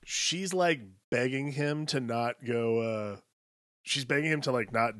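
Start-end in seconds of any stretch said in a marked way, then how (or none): none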